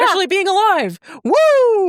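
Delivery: high, giddy whoop; mock joyful cheer. Ghostly station master cheering about being alive, giddy. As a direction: cheer like a man thrilled to be alive.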